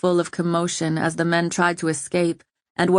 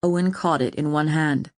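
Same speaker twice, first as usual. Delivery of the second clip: ordinary delivery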